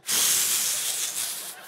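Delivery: a big gasp like before blowing out candles; sharp inhale. Shown as a demonstration. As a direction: suck in air to blow. Birthday cake blowing demo.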